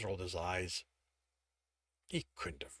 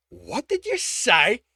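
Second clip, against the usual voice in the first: higher, louder and slow, dangerous; menacing challenge; 8 semitones higher, 12 dB louder, slower than usual. A narrator's reading. Tibbs provoked by the server, slow menacing challenge. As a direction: dare him to repeat it.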